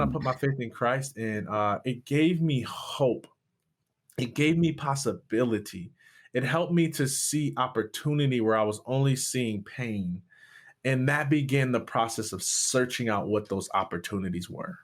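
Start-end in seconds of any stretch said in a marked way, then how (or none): none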